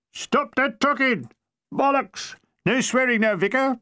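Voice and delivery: high, slow bellowing sergeant; angry sergeant yell. Acted. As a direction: bellow at the troops. Sergeant yelling at ranks.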